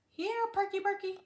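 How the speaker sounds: high call for a lost pet; calling the dog. Acted. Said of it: "called Arthur".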